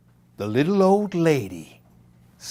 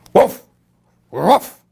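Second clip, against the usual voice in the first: barking dog; 5 semitones higher, 7 dB louder than usual. A narrator's reading.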